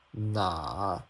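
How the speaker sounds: "拿" said as nǎ, dipping low then up; third tone. Shown as a demonstration. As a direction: scoop "拿" down and back up. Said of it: "the logic is all the same".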